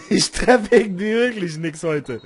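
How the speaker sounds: laughing while talking. Real, not acted. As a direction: laugh through the words. Laughing about missing shots.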